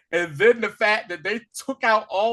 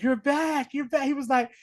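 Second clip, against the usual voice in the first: lightly voiced Deadpool, higher; mock Deadpool voice; 8 semitones higher than usual. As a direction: tease him like Deadpool.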